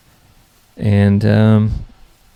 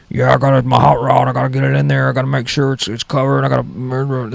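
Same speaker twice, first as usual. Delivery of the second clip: higher, put-on old roommate voice; a fussy old guy barging into the garage; crazy old guy; 4 semitones higher than usual. Old roommate interrupting to park his car, fussy put-on voice. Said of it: "I live with this crazy old guy".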